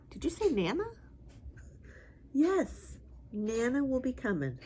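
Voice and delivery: high, bright excited question; excited pet voice. Real, not acted. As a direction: get the dog excited about Nana. Excited talk to dog.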